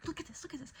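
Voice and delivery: high, urgent show-and-tell; retold excitement. Retold showing ex the billboard.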